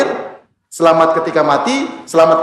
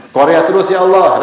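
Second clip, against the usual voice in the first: lightly voiced child protesting, louder; child accusing; 4 semitones higher, 5 dB louder than usual. Child protests to Allah that the mother lies, mock whiny. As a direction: play the child telling on the parent. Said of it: "Kata anaknya".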